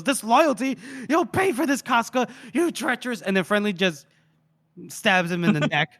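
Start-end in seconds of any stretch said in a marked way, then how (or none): 0.0-3.2 mock outrage. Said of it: "He goes".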